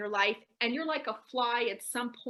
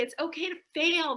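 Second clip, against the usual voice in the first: ordinary talk, nothing marked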